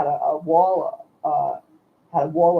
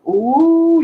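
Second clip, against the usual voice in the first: high, loud shocked ooh; a sharp gasp of dismay; 12 semitones higher, 9 dB louder than usual. Real, not acted. Hearing the saucer broke aquarium.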